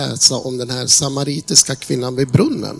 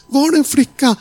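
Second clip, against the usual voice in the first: high, louder, breaking cry; 10 semitones higher, 3 dB louder than usual. Retold or imitated. Young woman repeating her question to the preacher, louder high crying wail. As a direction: wail the question again, much higher. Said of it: "Hon bara skrek ut".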